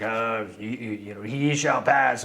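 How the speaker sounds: low, slow mock cave-of-wonders voice; tiger head voice. Imitating the sand tiger head from Aladdin, low and slow. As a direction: boom it out slowly like a sand monster.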